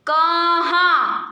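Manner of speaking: कहाँ stretched very slowly, nasal आ held; drawn-out demo word. Shown as a demonstration. Teacher asking pupils to pronounce, slow drawn-out कहाँ. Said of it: "देखो आप pronounce करो".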